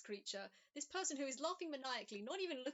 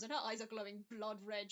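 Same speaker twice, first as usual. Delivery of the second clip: usual speaking voice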